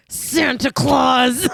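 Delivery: slow, strained and loud; through gritted teeth. A narrator's reading. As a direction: grind it out through clenched teeth. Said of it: "through gritted teeth".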